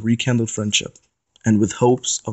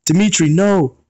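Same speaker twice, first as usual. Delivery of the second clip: shout; 6 semitones higher than usual. Acted. Aries trying to stop Dimitri in the car, alarmed shout. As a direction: try to stop him in time. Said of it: "Aries shouted".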